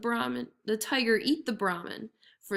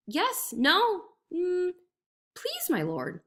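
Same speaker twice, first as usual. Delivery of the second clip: higher, dithering reply; flustered jackal; 9 semitones higher, much slower than usual. A narrator's reading. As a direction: pretend to be hopelessly lost.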